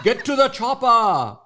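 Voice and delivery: loud, high, slow action-hero yell; badly faked Terminator shout; Arnold Schwarzenegger. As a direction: bellow it as a hammy Austrian action star. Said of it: "in Arnold Schwarzenegger's voice".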